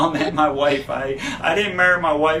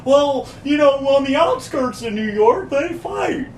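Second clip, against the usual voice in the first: much higher, louder put-on Kramer voice; mimicking Kramer; 11 semitones higher, 6 dB louder than usual. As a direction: explain it like a clueless know-it-all. Kramer voiced in retold scene.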